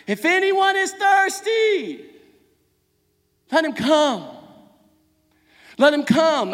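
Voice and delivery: high, loud preacher's cry, long held words. Acted. Jesus yelling his invitation to the thirsty crowd, crying out. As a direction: call it out to everybody in earshot. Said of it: "he's yelling this to everybody that".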